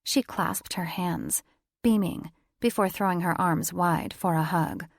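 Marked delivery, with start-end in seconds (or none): none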